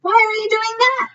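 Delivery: put-on high, curious little boy; lightly voiced curious child; small child voice. A narrator's reading. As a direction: ask it like a wide-eyed little kid. Child character asks, voiced high.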